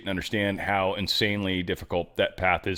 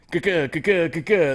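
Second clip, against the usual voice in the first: higher, louder "ka-ka" gunshot noises; mock gunfire; 6 semitones higher, 3 dB louder than usual. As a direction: spit out playful mouth gunfire. Mimicking gunfire sounds.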